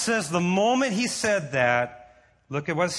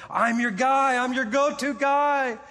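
high-pitched, keen mock avowal; volunteer yourself with eager confidence; eager pledge; 6 semitones higher than usual. Preacher voices the disciple pledging himself to the Lord, eager.

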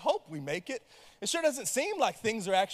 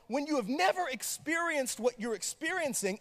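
ordinary talk, nothing marked

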